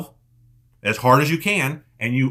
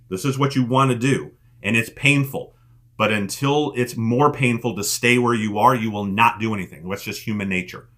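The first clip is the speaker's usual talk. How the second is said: ordinary talk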